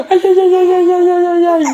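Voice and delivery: aïe aigus et forts, moitié rire; aïe en riant. Real, not acted. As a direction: plainte exagérée pour rire. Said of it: "dur de rire". (le bébé croque ses doigts, aïe forts en riant)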